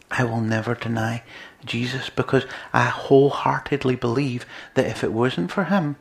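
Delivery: normal talking voice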